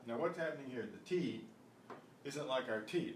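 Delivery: usual speaking voice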